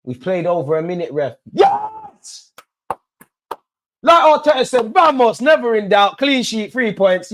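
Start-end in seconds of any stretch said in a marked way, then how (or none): 1.5-2.5 shrieking high cry of joy; fan's full-throated victory shout; triumphant yell. Real, not acted. Final whistle celebration. 4.0-7.3 higher and louder, celebrating; jubilant. Real, not acted. Jubilant after win.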